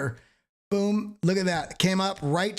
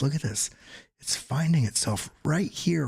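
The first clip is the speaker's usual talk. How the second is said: ordinary talk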